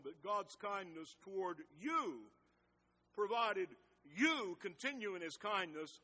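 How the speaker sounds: emphatic scripture reading, punching every "you"; stress on "you". Real, not acted. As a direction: read out firmly, leaning hard and long on "you". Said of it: "one word I'm going to emphasize".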